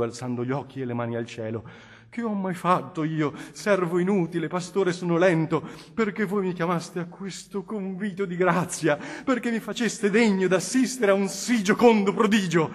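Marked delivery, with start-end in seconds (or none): 2.1-12.7 higher, louder rapturous cry to heaven; fervent exclamation. Acted. Federigo, hands raised to heaven, exclaims in joyful wonder. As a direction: praise God in joyful wonder. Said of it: "esclamò Federigo".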